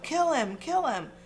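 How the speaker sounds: high urgent yelled command; a furious repeated order; yells. Acted. Voldemort yells at Quirrell, high urgent command. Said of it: "Voldemort yells of".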